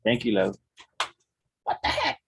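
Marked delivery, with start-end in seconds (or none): 1.6-2.2 high startled then firm; surprised. Real, not acted. Father startled, ordering child.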